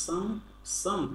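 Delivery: "some" said alone as a separate first syllable; teacher isolating "some"; syllable demo. Shown as a demonstration. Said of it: "ইং বলবো না".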